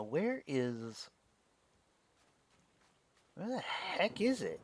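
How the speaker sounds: usual speaking voice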